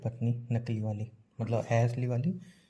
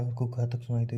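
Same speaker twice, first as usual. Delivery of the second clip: normal talking voice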